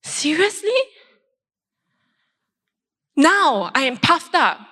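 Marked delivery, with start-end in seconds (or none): 0.0-0.8 high, offended "seriously?" rising; indignant disbelief. Retold or imitated. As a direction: protest in disbelief. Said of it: "I was like".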